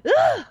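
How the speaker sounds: loud high ugh of disgust; a sharp, shrieked disgusted ugh; disgusted grunt. Retold or imitated. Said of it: "not be like".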